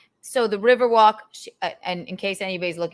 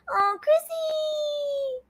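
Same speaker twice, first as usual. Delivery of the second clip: calling out; 3 dB quieter, much slower than usual. Real, not acted. Calling out to friend.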